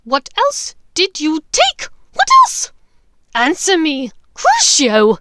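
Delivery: loud, very high, frenzied shriek; crazed shriek. Acted. Bellatrix shrieking at Hermione before the curse. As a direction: lose control completely.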